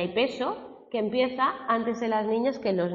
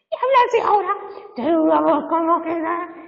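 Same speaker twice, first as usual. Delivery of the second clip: put-on much higher and louder, a squeaky cracking boy's voice; breaking teen voice; 10 semitones higher, 10 dB louder than usual. Teacher imitates a pupil whose voice is breaking, squeaky and cracking. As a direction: mimic a fourteen-year-old whose voice is cracking, pitched way up. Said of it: "tengo un niño que se le está cambiando la voz".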